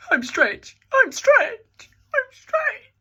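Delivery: hammed-up rising whine; mock wail. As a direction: build from a mock cry to a shrill wail. Repeats line, escalating to wail.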